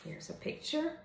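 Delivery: whispered aside. Real, not acted. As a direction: breathe it to the viewers. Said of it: "aside while showing the book".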